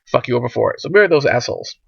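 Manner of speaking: ordinary talk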